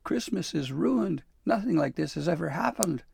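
high, loud acted wailing; sobbing. A narrator's reading. Dabbledy despairing to Santa over Christmas, acted sobbing wail. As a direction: lament it in tearful despair, pitched high. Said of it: "Dabbledy sobbed".

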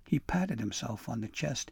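ordinary delivery

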